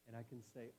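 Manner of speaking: ordinary delivery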